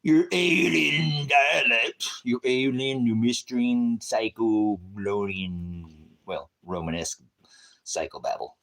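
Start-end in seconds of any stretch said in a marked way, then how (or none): 0.0-5.8 much higher, louder, very slow otherworldly voice; drawn-out spooky alien tones; stretched sci-fi alien voice, loud and high. Pretend. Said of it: "Your translated dialect".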